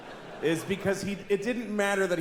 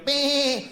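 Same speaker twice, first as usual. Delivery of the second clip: a higher, sweet little bleat; cute sheep bleat; 8 semitones higher, 4 dB louder than usual. Retold or imitated. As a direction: bleat like a storybook lamb.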